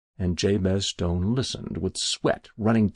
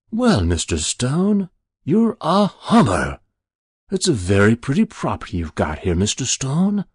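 lightly voiced smooth, genial stranger, louder than the narration; affable stranger; 5 semitones higher, 6 dB louder than usual. Stranger sizing up Jabez Stone's property, affable. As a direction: stretch the greeting, then run on pleasantly.

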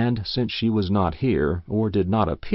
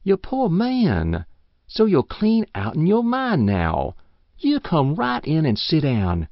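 put-on pitying old woman; 5 semitones higher than usual. She pities the confused old man.

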